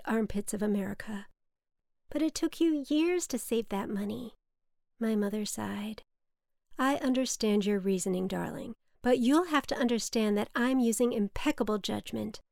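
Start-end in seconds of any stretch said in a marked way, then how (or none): none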